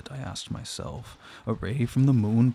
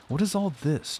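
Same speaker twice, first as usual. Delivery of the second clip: peremptory demand; 6 semitones higher than usual. Acted. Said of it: "demanded another voice, peremptorily".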